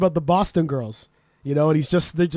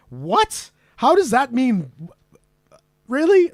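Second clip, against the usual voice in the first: baffled disbelief; 5 semitones higher, slower than usual. Retold or imitated. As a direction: pitch climbing high on each question. Reacting baffled to the slang list.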